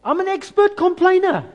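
high, loud self-mocking cry; exclaimed confession. Real, not acted. Pastor admits his own complaining to the congregation, loud and high. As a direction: blurt it out, half laughing at yourself. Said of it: "I battle with this".

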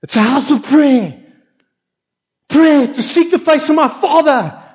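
high, furious shouting at the temple traders; Jesus's angry shout. Shown as a demonstration. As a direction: roar at them to get out of the house of prayer. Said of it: "I'm gonna demonstrate the one time where he exhibited this kind of behavior".

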